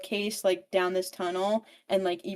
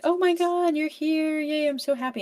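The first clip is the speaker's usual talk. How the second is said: high, louder fake delight; gushing welcome; 8 semitones higher, 4 dB louder than usual. Rena's parents greet Evie, high gushing delight. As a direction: overdo the sweet welcome.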